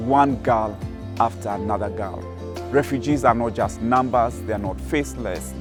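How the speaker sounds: usual speaking voice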